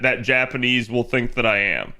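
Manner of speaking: ordinary talk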